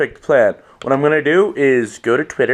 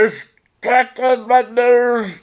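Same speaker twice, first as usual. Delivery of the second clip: higher and slow, a goofy put-on voice; silly character voice; 7 semitones higher, much slower than usual. Voicing a funny photo for viewers, goofy and slow. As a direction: drag it out like a dopey cartoon. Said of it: "try and make voices for stuff".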